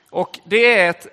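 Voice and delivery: usual speaking voice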